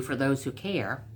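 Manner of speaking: plain reading voice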